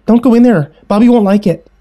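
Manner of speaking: put-on high, loud child's warning; warning little sister. A narrator's reading. Little sister stops sibling at closet handle, urgent warning. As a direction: cry out like a protective little girl.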